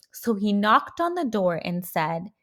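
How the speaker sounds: usual speaking voice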